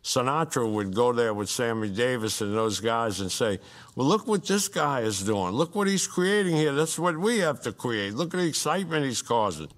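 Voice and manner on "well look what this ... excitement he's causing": lightly voiced, enthusiastic pal pointing something out. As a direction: pitch it up like a man urging his pals on. [name] quoted urging his crowd.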